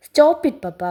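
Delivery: loud high sharp protest; angry outburst. Acted. Son cutting off his father's scolding, angry outburst. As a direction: cut him off hard.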